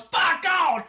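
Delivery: shouted high and loud; a commentator yelling in shock; yelling Jim Ross. Retold or imitated. Commentator Jim Ross yells at the ringside mess, loud shout. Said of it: "Jim Ross just yells".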